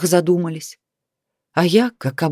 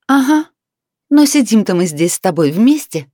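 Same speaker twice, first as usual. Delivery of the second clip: high, louder smirking retort; 4 semitones higher, 4 dB louder than usual. Acted. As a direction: taunt him with a grin. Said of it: "с ухмылкой напомнила я ему".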